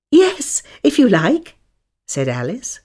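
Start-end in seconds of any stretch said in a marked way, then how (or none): 0.1-1.6 put-on girl voice. A narrator's reading. Alice voiced in a story.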